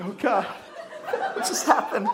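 slow, dazed, laughing disbelief; stunned teen. Retold or imitated. Embarrassed teen boy after the double kiss, slow and dazed. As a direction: draw it out in stunned confusion. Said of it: "I'm like".

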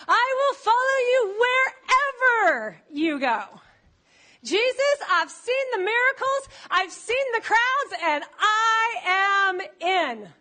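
high, slow, fervent vow to Jesus; eager follower. Acted. Preacher voicing a follower pledging to Jesus, high and slow. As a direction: swear it with wide-eyed zeal.

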